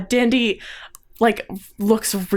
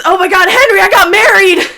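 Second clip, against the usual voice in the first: high, loud gush; excited outburst; 12 semitones higher, 11 dB louder than usual. Acted. Sister tells long-lost brother her news, squealing. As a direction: blurt the big news at high pitch.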